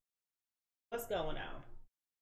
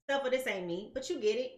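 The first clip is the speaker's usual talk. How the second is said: ordinary talk, nothing marked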